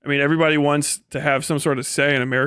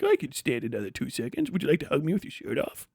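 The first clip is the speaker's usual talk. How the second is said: soft, hushed creepy teddy bear; 7 dB quieter than usual. Retold or imitated. Teddy bear creeping on a streamer, mock hushed. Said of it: "a teddy bear go like".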